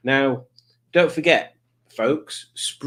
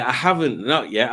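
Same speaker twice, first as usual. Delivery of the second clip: usual speaking voice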